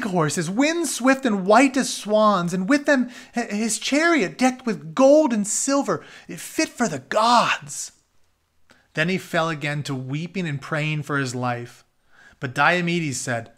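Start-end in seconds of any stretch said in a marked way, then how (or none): 2.5-13.5 storyteller reading a chapter aloud; reading aloud an Iliad retelling; story narration. A narrator's reading. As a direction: read the tale to the class, steady and clear.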